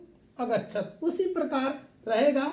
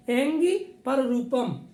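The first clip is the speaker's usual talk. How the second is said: "Angry पररूपम" spoken slowly and deliberately; slow recitation; slower than usual. Shown as a demonstration. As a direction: drag out the sutra for students to memorise. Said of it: "teacher repeats the sutra slowly". (सूत्र को धीरे-धीरे दोहराना)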